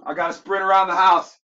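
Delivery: loud, high holler; excited shout. Real, not acted. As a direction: shout it out, all worked up. Worked up, shouting reaction.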